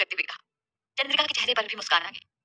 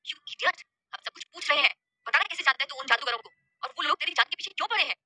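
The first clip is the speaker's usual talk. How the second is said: higher-pitched, exasperated scolding; 4 semitones higher than usual. Acted. Rajveer rebuking Shaurya for holding back, scolding. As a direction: demand answers in a raised, scolding pitch. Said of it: "Rajveer ने उसे डांटते हुए कहा".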